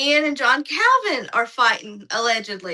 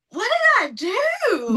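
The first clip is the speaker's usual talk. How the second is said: baffled; 9 semitones higher than usual. Retold or imitated. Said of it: "and I'm like".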